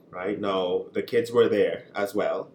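ordinary talk

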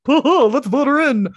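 high, loud mocking toff voice; snooty top hats. Top hats cheering to vote her in, mock posh. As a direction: ham up a pompous gentleman. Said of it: "all these top hats that were like".

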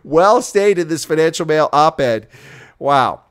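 loud emphatic praise; loud approval. Real, not acted. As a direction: cheer the quote on loudly.

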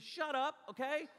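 high-pitched mock scolding; sharp rebuke. Preacher telling judges of worship to stop, mock-scolding. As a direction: cut the critics off abruptly.

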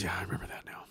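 quiet unvoiced aside; whispered aside. Real, not acted. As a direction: mutter it to yourself.

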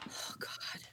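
breathless whisper under the breath; whispered oh God. Real, not acted. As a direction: whisper it, overwhelmed. Overwhelmed by choosing movies.

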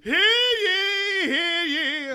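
booming high town crier call. Acted. Mock royal proclamation.

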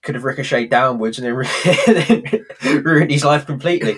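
put-on Del Boy line broken by laughter; can't hold it together, laugh through the punchline. Fan retelling Del Boy's punchline, laughing throughout. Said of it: "Del Boy goes".

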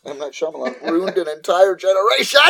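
loud, high comic rant; exasperated yell, played for laughs; mock outrage. Real, not acted. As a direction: build to an indignant shout. Mock outrage at the movie's director.

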